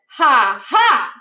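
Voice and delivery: put-on a sarcastic kid saying ha ha instead of laughing. A narrator's reading. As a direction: say the laugh as words, mocking. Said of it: "He actually said".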